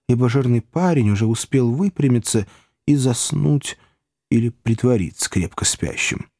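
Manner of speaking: plain reading voice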